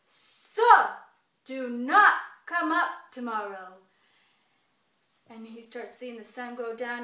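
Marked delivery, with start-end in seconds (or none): 0.1-3.8 very loud, slow command; commanding bear. Acted. Bear ordering the sun around, loud slow command. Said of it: "he's like".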